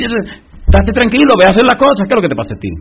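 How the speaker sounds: loud, high, impatient reassurance; exasperated scolding. Acted. God brushing off Moses' worries, exasperated scolding.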